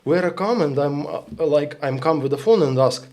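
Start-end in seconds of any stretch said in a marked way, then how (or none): none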